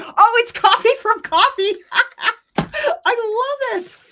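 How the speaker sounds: high, laughing, almost tearful delight; overjoyed at the coffee gift; excited squeal. Real, not acted. Thanking a viewer for the coffee, high tearful delight.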